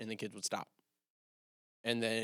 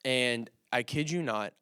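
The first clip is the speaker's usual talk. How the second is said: ordinary talk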